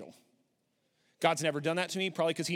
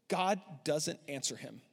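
usual speaking voice

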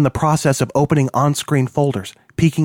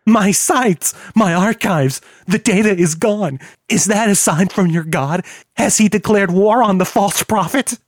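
anguished rant; 7 semitones higher than usual. A narrator's reading. Kilroy's rant after screaming.

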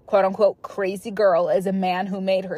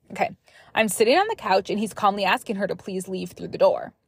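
usual speaking voice

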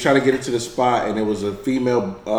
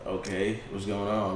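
calm retelling; 2 semitones lower, 9 dB quieter than usual. Retold or imitated. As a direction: say it softly, downplaying the anger. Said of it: "you ain't say that calmly".

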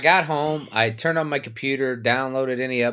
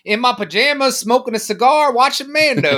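high, louder mock voice of the co-host; mocking imitation; 10 semitones higher, 4 dB louder than usual. Co-host mocking the one who stayed home, mocking imitation. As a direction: taunt him by playing his part, pitched way up.

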